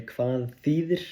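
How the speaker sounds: "Hvað þýðir" said slowly as kvath thee-thir; unhurried learner demo; Icelandic phrase demo. Shown as a demonstration. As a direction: model "Hvað þýðir" carefully. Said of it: "you can also say".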